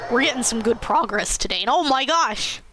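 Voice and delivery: ordinary delivery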